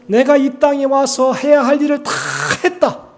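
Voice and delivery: high, loud preacher's proclamation; triumphant declaration. Acted. Preacher voices Jesus finishing his work, triumphant declaration. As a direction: declare it loudly, building to a held word before the last.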